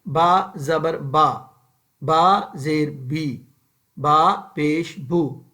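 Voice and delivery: "با زبر با" with short unstretched vowels, slowly; slow tajweed drill of short harakat. Shown as a demonstration. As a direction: recite "با زیر بی" crisply, no lengthening. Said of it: "ہم نے جب بھی پڑھنا ہے".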